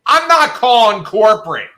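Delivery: loud, high indignant refusal; mock shout. Shouting at the manager that she won't call corporate. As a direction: yell the refusal, outraged. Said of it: "and I'm like".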